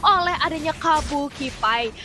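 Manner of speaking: fighting game announcer. Real, not acted. Live play-by-play of teamfight.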